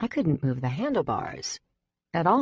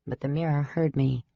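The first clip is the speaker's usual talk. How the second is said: plain reading voice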